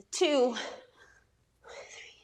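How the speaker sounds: soft, breathless whispered counting; real exertion whisper while counting reps; strained whisper. Real, not acted. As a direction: count on pure breath mid-exercise. Counting tuck-ups while working.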